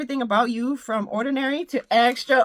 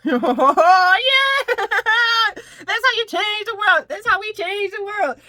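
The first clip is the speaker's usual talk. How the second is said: excited cheer; 9 semitones higher, 7 dB louder, slower than usual. Real, not acted. Loud laughing cheer.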